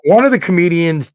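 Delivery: usual speaking voice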